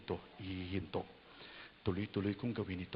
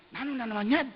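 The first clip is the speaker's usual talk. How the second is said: much higher, louder whiny complaint; annoyed complainer; 13 semitones higher, 8 dB louder than usual. Retold or imitated. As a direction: whine in irritation. Voicing annoyed person. (inis na reklamo)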